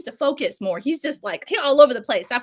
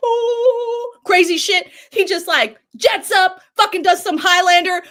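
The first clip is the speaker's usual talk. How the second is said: excited buildup; 7 semitones higher, 8 dB louder, slower than usual. Real, not acted. Describing wild superhero action.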